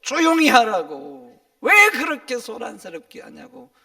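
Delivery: acting out angry shushing at full volume; shouted scolding. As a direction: scold a noisy crowd into silence. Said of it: "소리치는 그 사람들 향해서".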